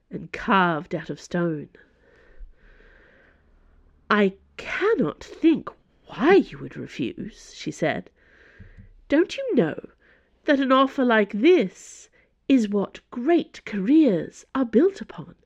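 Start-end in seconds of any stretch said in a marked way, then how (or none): 4.1-7.5 slow, higher-pitched imperious voice; flinty Cardinal. Acted. Cardinal, flinty, rebukes refusal. 9.1-15.4 slow, higher-pitched imperious voice; flinty Cardinal. Acted. Cardinal presses the offer.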